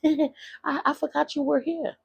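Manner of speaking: high-pitched giggly put-on voice; giggle, then mimic her far above your own pitch; imitated laughing mother. Mother laughing at the child she forgot, amused. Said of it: "And she's laughing".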